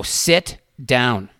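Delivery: "Sit down" as two loud, separate words; firm command. Retold or imitated. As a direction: cut through the ranting with a firm "Sit down". Repeated order over man's ranting.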